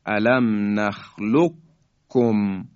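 slow "نخلقکم" said as nakhluk-kum; "نخلقکم" with a held, doubled kaf; qaf merged into kaf. Shown as a demonstration.

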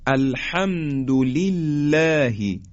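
الحمد لله with thin lam and fronted "læ"; slowly recited الحمد لله, keep لله light; light tarqiq. Shown as a demonstration.

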